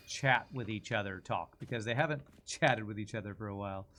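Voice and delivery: ordinary talk, nothing marked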